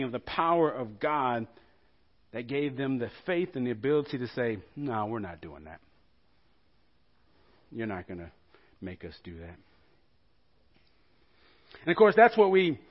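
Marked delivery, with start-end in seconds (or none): 4.8-9.6 quiet, low and firm refusal; defiant refusal. Retold or imitated. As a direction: say it softly but immovably. The three men defy the king.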